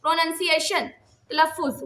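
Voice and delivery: normal talking voice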